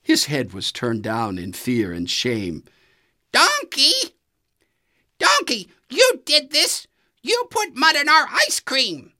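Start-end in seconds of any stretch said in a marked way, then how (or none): 3.3-9.1 very high, loud accusing goose voice; Goose calling out. A narrator's reading. Goose accusing Donkey of the trick, high indignant call. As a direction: call him out from across the road. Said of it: "Goose called to Donkey".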